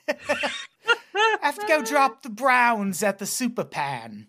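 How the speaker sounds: high, slow laughing put-on British; laughing mock British. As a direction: giggle through a posh English joke. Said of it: "call the toilet a pan in the U K".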